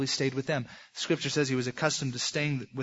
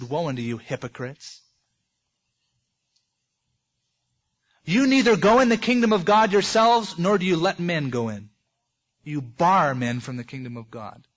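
mock rebuke; 4 dB louder than usual. Jesus rebuking hypocrites.

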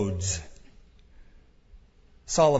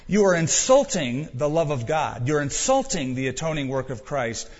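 normal talking voice

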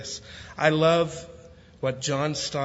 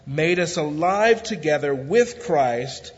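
normal talking voice

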